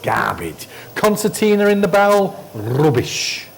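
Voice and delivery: scoffing garbage, garbage; contemptuous teacher rejecting a technique; scornful dismissal. Real, not acted. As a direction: brush the idea off with disdain.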